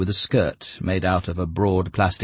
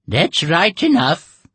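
slow, higher, louder put-on voice; gruff dealer; 12 semitones higher, 8 dB louder, slower than usual. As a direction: drag it out like a shady dealer. Bearded dealer answers from above.

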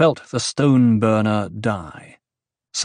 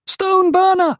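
high panicked scream of "Stone burner!"; 5 dB louder than usual. A narrator's reading. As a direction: scream it in terror, much higher. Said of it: "someone screamed".

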